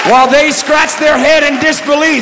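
high, rhythmic preaching with slowed shouted lines; preaching at full force. Acted. Preacher telling the church why the universe keeps expanding, shouting. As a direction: build the church up to a climax. Preacher builds to his climax.

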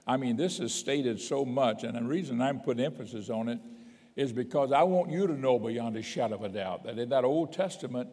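usual speaking voice

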